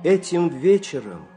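ordinary delivery, nothing marked

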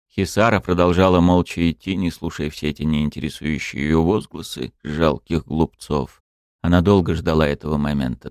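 ordinary delivery, nothing marked

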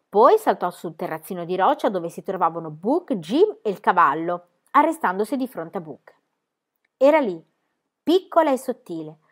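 ordinary delivery

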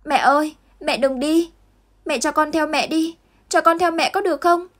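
put-on a little boy begging his mother not to leave; mild child's cry, pitched high; shouting child. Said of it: "Việt Anh hét lớn".

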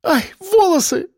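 very high yelp of pain; pained squeal. A narrator's reading. Branch catches fleeing woman's hair, pained squeal. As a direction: squeal as your hair gets caught. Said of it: "и она завизжала".

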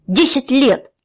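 the outrage peaks on the repeat; anguished repeat. A narrator's reading. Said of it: "взбеленился Тай".

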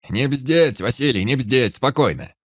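loud commanding yell. A narrator's reading. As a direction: shout him out of panic. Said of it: "заорал дядя Саша".

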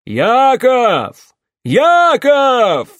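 loud, high bellowing call; shout. Acted. Карпас орёт, зовя старика Якова из темноты, во весь голос. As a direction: yell the name across the dark, full voice. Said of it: "заорал".

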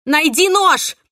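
loud, high urgent shout. A narrator's reading. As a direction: yell the order in a struggle. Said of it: "крикнула я Венди".

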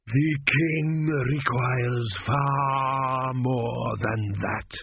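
extremely slow, drawn-out intoning; weighty pronouncement. A narrator's reading. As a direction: stretch it out like a ritual chant. Priest overrules king after deep breath.

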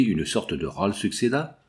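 ordinary delivery

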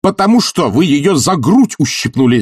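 high, heated accusation; angry shout. Acted. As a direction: shout the charge over the other's protest. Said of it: "закричал Барменталь".

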